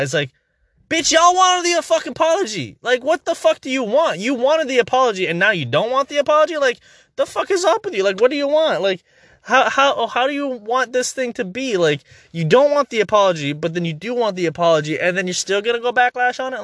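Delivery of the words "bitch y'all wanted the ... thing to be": high-pitched ranting at the complainers; incredulous, high-pitched tirade; exasperated outburst. Acted. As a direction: pitch it way up in fed-up disbelief.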